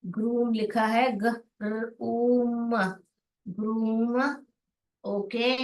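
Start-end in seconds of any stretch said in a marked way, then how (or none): 0.0-4.2 र then ऊम, very slow, then ग्रूम; blending ग्रूम. Shown as a demonstration. Teacher to kids, slowly blending ग्रूम. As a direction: stretch ग्रूम out sound by sound. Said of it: "जोड़ जोड़ के पढ़िए".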